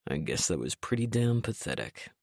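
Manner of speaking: low, soft rueful voice; sheepish man. A narrator's reading. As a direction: quietly admit it. Trent, sheepish.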